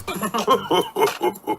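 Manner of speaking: high piglet giggle. A narrator's reading. Piglet giggling at the big bed, high soft giggle. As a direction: giggle softly with delight.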